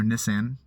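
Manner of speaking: Nissan tried as "NISS-an"; short i, flat "a" as in cat in Nissan; attempted British pronunciation. Shown as a demonstration. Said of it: "so I can't say it right".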